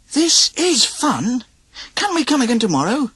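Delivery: put-on high, delighted kids' chant; happy chorus. A narrator's reading. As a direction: chime in together gleefully. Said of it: "chorused his nephews".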